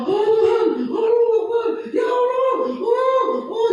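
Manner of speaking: high panicked crying out to God, shouting; hysterical cries. Retold or imitated. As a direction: mimic a crowd wailing in panic during a quake. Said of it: "histeris semuanya". (teriakan histeris panik, nada tinggi)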